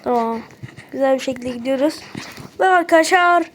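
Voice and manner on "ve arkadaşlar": louder, higher triumphant build-up; real excitement announcing the finish; excited announcement. Real, not acted. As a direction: announce the finish to friends with a flourish. Reaches the game's finale.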